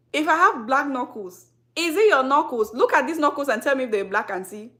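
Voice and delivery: loud, emphatic rhetorical questioning; indignant challenge. Real, not acted. Creator confronting skin critics about her knuckles, indignant. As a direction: hit each question hard, confronting the critic.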